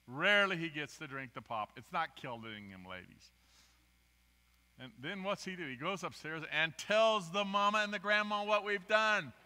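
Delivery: normal talking voice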